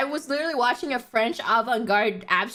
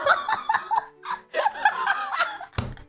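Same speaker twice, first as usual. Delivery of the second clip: a long, high burst of laughter; high helpless laugh. Real, not acted. Cracking up at her own story for chat. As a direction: lose it completely, laughing.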